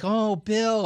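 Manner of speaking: high-pitched, exaggerated pitying coo; mock sympathy. Co-host imitating everybody feeling sorry for the host, mock sympathy. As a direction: gush with pity, pitch way up. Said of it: "fastest rise of sympathy for you".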